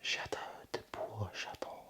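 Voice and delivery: hushed whisper, sneaking around; breathy secret whisper. Real, not acted.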